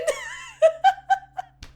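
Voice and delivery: high squealing laugh; shrieking laugh. Real, not acted. Fan shrieking with laughter at the scene. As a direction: let the laugh fly up high.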